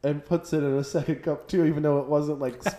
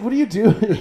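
loud, higher, incredulous; 7 semitones higher, 9 dB louder than usual. Retold or imitated. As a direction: shout it in disbelief, half laughing. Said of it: "it's like".

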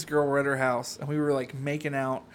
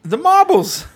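high, loud cartoon Canadian voice; a hammed-up Canadian from up north; mock Canadian accent; 12 semitones higher, 8 dB louder, slower than usual. Telling Justin where he lives, in a mock Canadian accent.

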